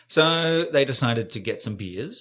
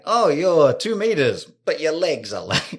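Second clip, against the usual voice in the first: mock exclaiming; 6 semitones higher, 7 dB louder than usual. Mock measuring somebody.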